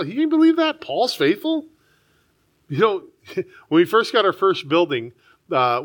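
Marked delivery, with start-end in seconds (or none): 0.0-1.7 amazed. Real, not acted. Pastor marvels at Paul's calling.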